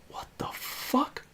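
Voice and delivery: slow, drawn-out whisper of disbelief; stunned whisper. Retold or imitated. Kid staring at the bully's outstretched hand, stunned slow whisper. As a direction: whisper it slowly, baffled.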